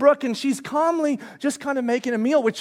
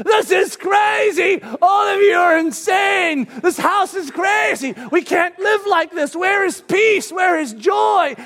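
slow, high, loud ranting shout; 9 semitones higher, 5 dB louder, slower than usual. Retold or imitated. Dad on the stairs to the whole house: slow shouting. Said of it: "I just start shouting".

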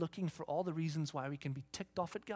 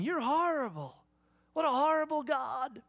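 shouted accusation; 8 semitones higher, 4 dB louder than usual. Retold or imitated. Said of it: "shout in my face".

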